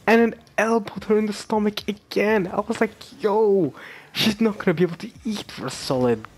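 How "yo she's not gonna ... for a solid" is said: shocked reaction. Retold or imitated. Fan reacting to stomach blows, high stunned exclamation. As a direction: burst out in amused disbelief. Retelling own shock at beating.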